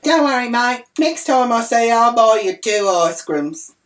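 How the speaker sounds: loud, high call across a distance; yelling out. Retold or imitated. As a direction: holler it to the kid over there. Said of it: "I yelled out".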